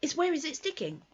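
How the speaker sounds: a busy, anxious rabbit puzzling it out. Acted. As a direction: Rabbit worrying over a detail. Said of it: "said Rabbit".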